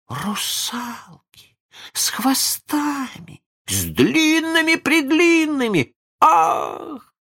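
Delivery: высоко и медленно, нарастая от тихого к громкому; захлебнуться восторгом; восторженный вскрик. Acted. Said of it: "вскричала Венди". (Венди в восторге от русалок, всё громче)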